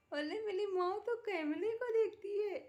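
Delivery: high-pitched sing-song coo to a pet; high excited coo at the pet on camera. Real, not acted.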